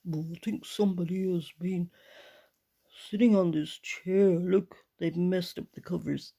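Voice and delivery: low, grumbly father bear; Daddy Bear voice. Acted. Daddy Bear finds his chair messed up, deep voice. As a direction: drop into a deep papa bear voice.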